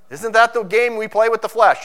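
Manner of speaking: loud, rising, driving sermon voice; preaching at full force. Acted. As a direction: hammer it home from the pulpit.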